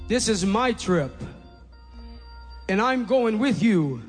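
ordinary talk, nothing marked

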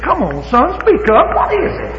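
high, rising urging voice; God as a coaxing father. Retold or imitated. Preacher imagining God urging a shy son to speak. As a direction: coax him to speak up like a father. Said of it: "I can imagine he said".

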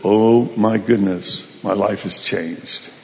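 slow, low, sinking realization; acted stunned dismay. Husband sees his wife changed, stunned dismay. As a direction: let it sink in slowly, low.

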